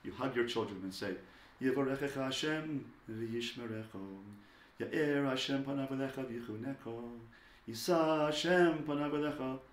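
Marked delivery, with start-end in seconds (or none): 1.6-9.6 slow, half-chanted Hebrew blessing; gently intoned parental blessing; warm, loving blessing. Shown as a demonstration. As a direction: draw out each phrase tenderly over the child. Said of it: "it must be warm and slow and loving".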